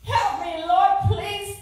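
loud, high, desperate cry for help; preacher reliving the stroke, pleading; panicked plea. Retold or imitated. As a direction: cry out to the Lord in alarm. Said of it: "I said".

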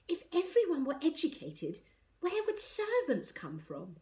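high-pitched, mock-confidential stage whisper; exaggerated whisper. A narrator's reading. Ivy makes sure the maid hears, exaggerated whisper. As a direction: make a show of whispering. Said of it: "added in an exaggerated whisper".

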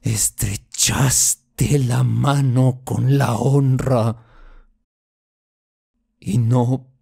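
weighty, drawn-out accusation; solemn reproach. Acted. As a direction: pronounce the reproach slowly, with rising force. Final reproach to his sister.

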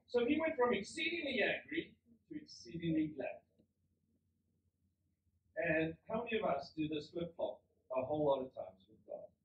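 ordinary talk, nothing marked

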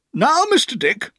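put-on high, firm old aunt. A narrator's reading. Aunt addresses Mister Dick firmly. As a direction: settle him down with authority. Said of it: "said my aunt firmly".

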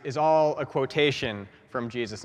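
ordinary delivery

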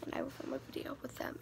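quick conspiratorial whisper; fast secret whisper. Real, not acted. Secret plan about cameraman.

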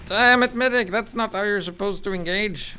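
loud, high exasperated yell; man down shout. Real, not acted. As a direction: shout at the medic in frustration. Teammate dies, he yells.

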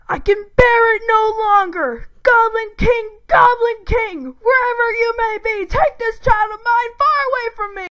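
loud, high theatrical scream; screamed play lines. Acted. Boy rehearsing his play, summoning the Goblin King, screaming. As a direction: belt out a dramatic plea to the heavens. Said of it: "when you screamed the words".

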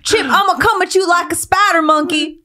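high-pitched movie brat; high, hammed-up Southern kid voice; mock kid threat. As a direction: imitate a kid threatening grandpa. Quoting kid threatening grandpa.

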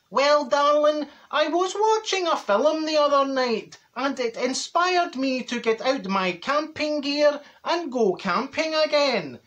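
slow, sing-song camp voice rising high on darling; hammed-up luvvie neighbour, drawn out. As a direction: neighbour showing off his foil blanket, camp and slow. Neighbour quoted explaining film.

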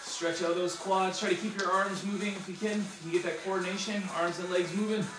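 upbeat workout coach cues; aerobics instructor. Acted. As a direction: coach the class through drills. Fitness instructor cueing a workout.

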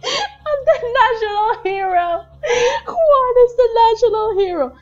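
real overjoyed victory yell; triumphant high shout. Real, not acted. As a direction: crow it to the whole world. Celebrating the win.

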